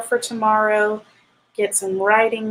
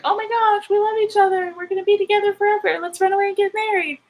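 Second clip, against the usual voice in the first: high-pitched mock gushing sweethearts, rushing toward the end; gushing lovers; 11 semitones higher, faster than usual. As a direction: imitate giddy lovers in a high voice. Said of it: "they were like".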